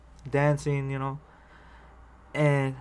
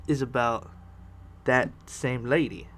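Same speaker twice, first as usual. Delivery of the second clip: ordinary delivery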